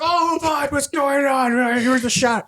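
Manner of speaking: put-on high, giddy drunk voice; lightly hammed-up tipsy boss; mock drunk boss. Imitating drunk bosses handing out shots.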